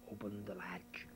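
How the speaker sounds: usual speaking voice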